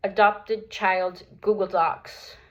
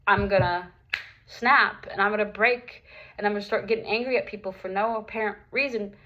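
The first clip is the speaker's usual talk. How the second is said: ordinary talk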